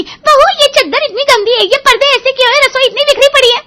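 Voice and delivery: high, loud mother-in-law imitation; mock carping in a put-on voice; scolding mother-in-law. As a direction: mimic her fault-finding. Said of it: "तुम्हारी माँ आते ही कहेगी".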